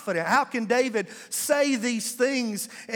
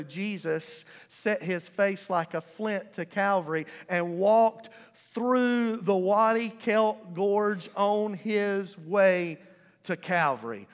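ordinary delivery

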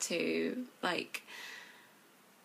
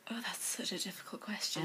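quick, softer whisper; whispered fluster; 6 dB quieter, faster than usual. Real, not acted. Guest stumped by the interview question, quick flustered whisper. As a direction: breathe it out, caught off guard.

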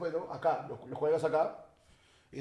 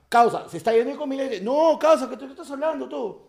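loud, higher heated back-and-forth; retold argument; 8 semitones higher, 9 dB louder than usual. Quarrel with the thief over the glasses, heated loud. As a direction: argue it out on the street.

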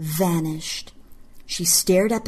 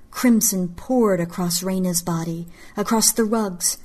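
usual speaking voice

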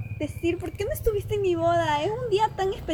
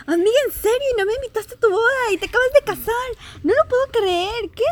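high, loud outraged surprise; can't believe she wasn't invited; shocked disbelief; 6 semitones higher, 6 dB louder than usual. Acted. Friend learns of secret wedding.

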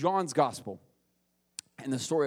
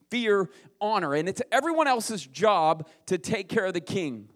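higher and louder, building emphasis; rising preaching; 5 semitones higher, 5 dB louder than usual. Acted. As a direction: preach the king's rights with force. Preaching builds about kingship.

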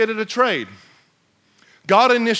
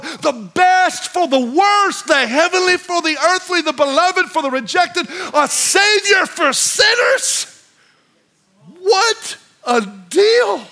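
building preacher climax; 7 semitones higher than usual. Acted. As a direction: build it up till you shout it.

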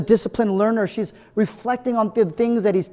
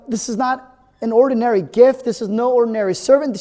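ordinary talk

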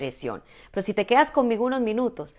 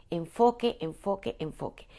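"Enfoque" three times, each softer until almost gone; fading repetition; 4 dB quieter than usual. Shown as a demonstration. Coach hammering home her point, "Enfoque" fading out. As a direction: let "Enfoque" drop away with each repeat.

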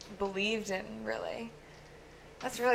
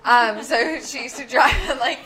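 laughing; 5 semitones higher, 6 dB louder than usual. Real, not acted. Laughing fit mid-sentence.

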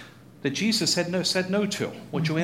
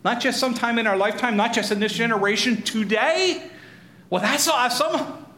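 raised pitch, delighted astonishment; amazed excitement; 6 semitones higher than usual. Acted. Synagogue crowd hears that it is happening today, amazed excitement.

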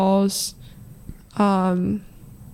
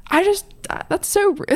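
high, exasperated disbelief; outraged at rude fans; indignant; 12 semitones higher than usual. Real, not acted.

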